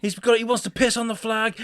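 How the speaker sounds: high-pitched mocking indignation; mock outraged American. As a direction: mimic an American getting worked up. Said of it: "You Americans, though, you do make me laugh".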